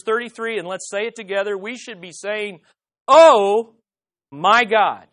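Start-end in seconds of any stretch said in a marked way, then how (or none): 3.1-5.1 awed shout on OH; emphatic exclamation. Shown as a demonstration. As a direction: punch a big drawn-out OH, pause, then MY GOD. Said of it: "you gotta put the emphasis on".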